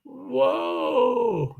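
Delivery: surprised whoa. Real, not acted. Reacting to comment.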